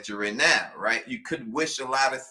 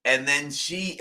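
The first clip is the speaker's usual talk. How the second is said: ordinary talk, nothing marked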